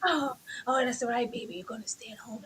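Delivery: mock breathy pillow talk. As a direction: murmur like lovers in bed. Mocking whispered pillow talk.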